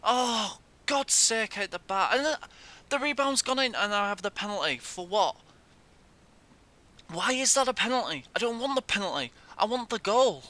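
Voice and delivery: very high, loud real exasperation; high exasperated outburst. Real, not acted. Gamer protesting he got a penalty not a goal, high loud exasperation. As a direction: wail it in frustrated disbelief.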